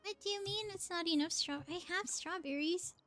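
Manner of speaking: ordinary delivery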